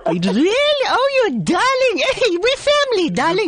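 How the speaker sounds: man's high falsetto auntie; high, singsong gushing auntie; female auntie voice. Acted. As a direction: squeal it like a flattered auntie. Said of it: "from a male voice you change into a female voice".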